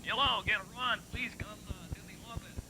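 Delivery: distant, fading loudspeaker call; PA announcement voice. Retold or imitated. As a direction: call it like a far-off school PA. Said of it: "How would that sound?".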